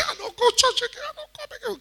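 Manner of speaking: very high, whiny voice; sulky member quitting church; mock sulk. Pastor mocks a scolded member quitting, high and whiny.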